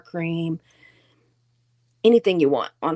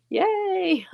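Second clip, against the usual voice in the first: little cheer; 11 semitones higher than usual. Real, not acted. Cheering about local taco sauce.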